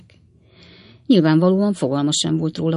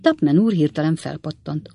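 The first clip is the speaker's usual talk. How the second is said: ordinary delivery, nothing marked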